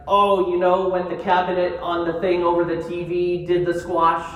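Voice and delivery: mother's muddled story. Retold or imitated. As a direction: retell mom's jumbled anecdote with loud insistence. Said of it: "they usually come out with something like".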